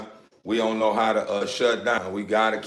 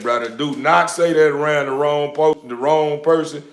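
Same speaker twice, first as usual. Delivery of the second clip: loud warning; 5 semitones higher, 6 dB louder than usual. Real, not acted. Warning viewer.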